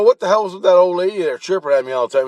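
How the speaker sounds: worked-up, rapid-fire protest; indignant questioning. Retold or imitated. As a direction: demand an answer, acting out your exasperation. Retold indignant question to Jesus.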